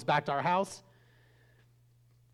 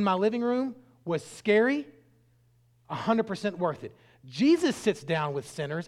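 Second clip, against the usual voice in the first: raised, punchy sermon delivery; emphatic preaching; 6 semitones higher, 5 dB louder than usual. Real, not acted. Preacher urging church to eat with sinners, emphatic preaching. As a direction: drive each point home to the congregation.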